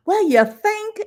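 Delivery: high-pitched mock retort; sarcastic comeback. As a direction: act out an incredulous, sarcastic comeback. Retold sarcastic comeback to paramedic.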